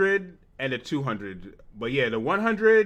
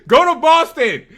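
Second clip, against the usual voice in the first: laughing dare; 10 semitones higher, 9 dB louder than usual. Real, not acted. Laughing dare about Boston.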